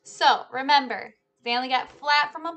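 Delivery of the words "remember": ordinary delivery, nothing marked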